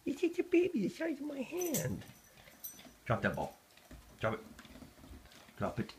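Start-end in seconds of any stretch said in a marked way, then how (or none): 0.1-2.0 much higher sing-song pleading with the dog. Real, not acted. Defending kitten to dog.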